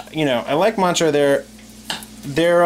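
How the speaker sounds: normal talking voice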